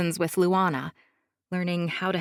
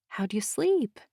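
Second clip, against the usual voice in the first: whispering; 4 semitones higher than usual. Acted. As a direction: breathe it so the baby sleeps. Whispering near sleeping baby.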